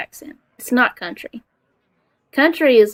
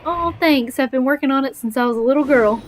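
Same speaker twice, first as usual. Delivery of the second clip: higher-pitched quoted mom; mom's quip; 5 semitones higher than usual. Retold or imitated. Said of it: "As my mom would say".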